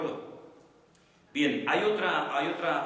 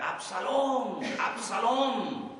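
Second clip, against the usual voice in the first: high, drawn-out mock cry of grief; 8 semitones higher, much slower than usual. King David crying out for his dead son Absalón, acted grieving cry. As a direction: call out the name in pained, long high notes. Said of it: "comenzó a gritos en el palacio a gritar".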